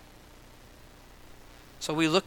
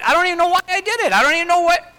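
exasperated disbelief; 11 semitones higher, 8 dB louder, faster than usual. Real, not acted. Baffled at his own lie.

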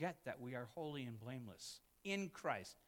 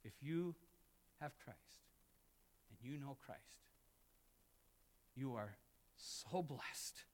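much softer, slow reassurance; tender hush; 2 semitones lower, 7 dB quieter than usual. Real, not acted. Tender closing blessing.

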